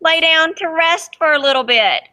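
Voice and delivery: reading a picture book aloud to the class; lively read-aloud for kids; storybook reading. A narrator's reading. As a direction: tell it gently to children, letting the wonder show. Teacher reads story to children.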